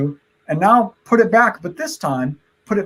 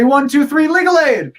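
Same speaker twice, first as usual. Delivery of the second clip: put-on kids party magician; 8 semitones higher, 5 dB louder than usual. Countdown chant of magic word.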